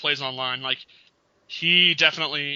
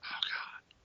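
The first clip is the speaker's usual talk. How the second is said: whispered groan of despair; exasperated whisper; 15 dB quieter than usual. Real, not acted. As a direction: breathe it out, fed up. Groaning over the backbreaker move.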